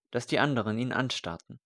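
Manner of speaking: ordinary delivery, nothing marked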